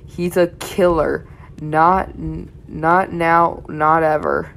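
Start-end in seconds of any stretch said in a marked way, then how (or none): none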